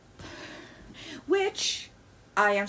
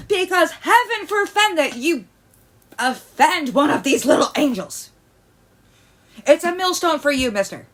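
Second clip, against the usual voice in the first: mocking lecture; 6 semitones higher, 7 dB louder than usual. Mocking strict parents' rules.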